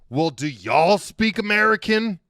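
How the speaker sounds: loud, high American drawl; exaggerated American tourist drawl; mock American accent. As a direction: bellow it slowly like an American tourist. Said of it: "They've got an accent".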